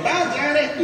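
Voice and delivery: high singsong imitated farewell; cheery called goodbye. Reverend calls out while driving off.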